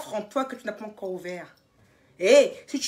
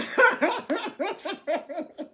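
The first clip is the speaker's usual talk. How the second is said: giggle; 5 semitones higher, 4 dB quieter than usual. Real, not acted. Laughing at her own slip.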